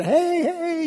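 high, bright mock calling across a street. Parade-goers hailing each other, high calling out. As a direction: wave and call to a friend.

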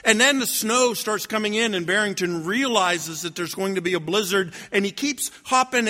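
usual speaking voice

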